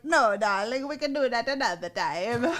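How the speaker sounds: suave, lightly put-on Italian accent; smooth Italian brush-off to his date; a hint of a charming Italian accent. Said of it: "I love the Italian accent".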